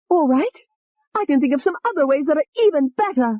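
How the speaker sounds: high, venomous female alter; high hateful young woman; vicious Tony. Acted. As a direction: sneer the threat up high. Tony's voice.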